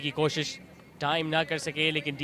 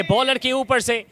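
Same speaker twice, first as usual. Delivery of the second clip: higher and louder with suspense; excited cricket commentary; 6 semitones higher, 6 dB louder than usual. Real, not acted. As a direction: build tension as the ball flies. Ball flying toward fielder.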